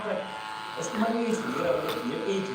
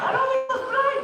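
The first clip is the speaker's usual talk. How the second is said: much higher, shouted crowd hype; excited shout; 3 dB louder than usual. Real, not acted. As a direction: whip the crowd up. Asking the crowd for cheers.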